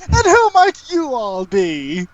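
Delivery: high, slow, theatrical question; inquisitive wizard creature. Acted. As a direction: greet strangers in a high, drawn-out wizard voice.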